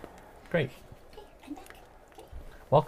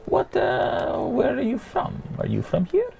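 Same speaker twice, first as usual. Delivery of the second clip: put-on high, curious old man at the campfire; friendly old man questioning a stranger; old gentleman voice; 8 semitones higher than usual.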